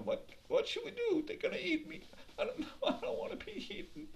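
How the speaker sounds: high, thin, frightened deer voice with a nervous laugh; panicked squeak. Acted. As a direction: squeal it like a terrified little deer. Deer panics about being eaten.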